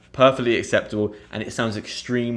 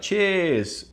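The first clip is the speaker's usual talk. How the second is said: toast cheers; 7 semitones higher than usual. Shown as a demonstration. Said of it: "when you have a beer".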